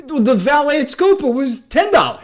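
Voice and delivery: loud, high, laughing exasperation; exasperated. Real, not acted. Telling a friend the punchline, loud laughing exasperation. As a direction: blurt it out, pissed but amused. Peak of the annoyed anecdote.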